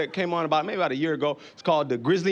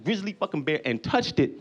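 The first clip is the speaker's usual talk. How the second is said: ordinary talk, nothing marked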